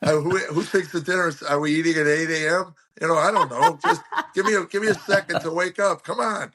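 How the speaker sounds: high, laughing through the words; real laughter breaking into the rant; laughing while talking. Real, not acted. Laughing while telling the story.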